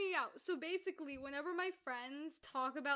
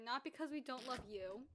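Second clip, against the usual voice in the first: mock divorcing parent; 4 semitones lower, 8 dB quieter than usual. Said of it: "Mommy and Daddy separated".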